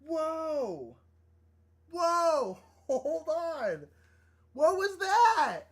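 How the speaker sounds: high, loud, stunned whoas; high-pitched stunned outburst; real shock. Real, not acted. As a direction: blurt it out, startled by a surprise gift. Startled by a sudden stream donation.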